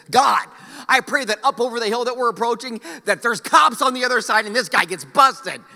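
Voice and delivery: loud, high, hammed-up prayer shout; mock fervent prayer. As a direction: pray loudly that the cops catch him. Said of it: "and I said".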